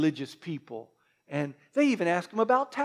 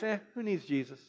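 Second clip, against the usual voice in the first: dismissive shrug; 11 dB quieter than usual. Retold or imitated. Friday crowd dismissive.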